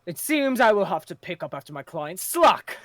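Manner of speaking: a theatrical rival mocking the party's slack; smug villain voice. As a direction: sneer it down at them from above. Rival character taunts the party.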